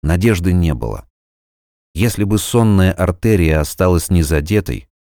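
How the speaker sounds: ordinary delivery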